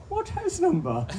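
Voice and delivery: very high, loud comic disbelief; astonished question. Retold or imitated. Kayaker finds a neighbour from the same village, astonished. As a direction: squeal it as an incredulous punchline. Punchline to astonished retold exchange.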